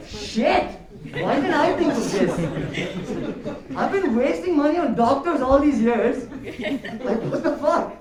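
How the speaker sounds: high, loud sarcastic amazement; mock epiphany. Asthmatic reacting to friend's breathing advice, in mock amazement. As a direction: exclaim as if it's a huge revelation.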